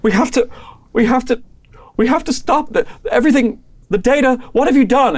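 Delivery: high, loud, stammering urgency; reading the readout in alarm; frantic panic. Acted. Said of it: "he's like".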